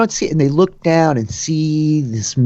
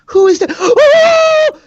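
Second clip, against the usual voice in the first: high, loud gasp and scream of fright; frightened shriek; 7 dB louder, slower than usual. Acted. Startled tavern woman spotting a wolf, shrieking.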